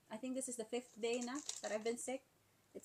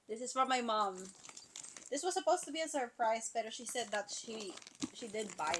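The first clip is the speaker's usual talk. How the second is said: normal talking voice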